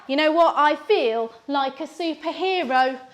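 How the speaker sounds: ordinary talk, nothing marked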